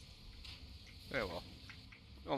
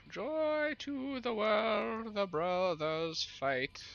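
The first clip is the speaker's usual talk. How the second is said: high, slow cackling witch singsong; crotchety witch voice; 13 semitones higher, 3 dB louder, much slower than usual. Pretend. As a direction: croon it like an old crone. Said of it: "it's just my old crotchety witch voice".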